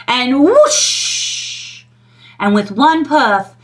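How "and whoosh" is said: whoosh sound. A narrator's reading. Wind of blown house.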